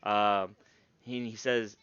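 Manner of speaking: ordinary delivery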